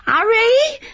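shrill screaming of his name as she falls; terrified scream. Acted. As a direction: scream for help, slipping. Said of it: "She screamed".

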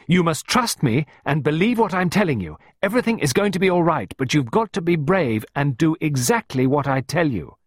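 loud, driving reassurance; forceful. A narrator's reading. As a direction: press the child to obey and be brave. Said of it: "Clarissa continued more forcefully".

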